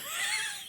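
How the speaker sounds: high squeaky giggle. Acted. Narrator giggling mid-sentence. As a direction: let a giggle slip.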